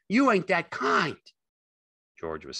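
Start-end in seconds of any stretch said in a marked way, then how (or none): 0.1-1.2 high, loud shout of faith; crying out. A narrator's reading. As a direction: shout it insistently. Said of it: "Lenny cried".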